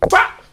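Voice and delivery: short "pa" smack noise; imitated slap. Mom lands second slap in the story, imitated smack. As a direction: pop the syllable out abruptly.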